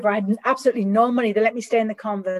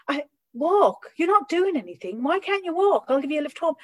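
exasperated lecturing; 7 semitones higher than usual. Retold or imitated. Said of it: "I'm like".

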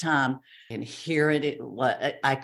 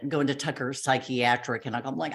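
ordinary talk

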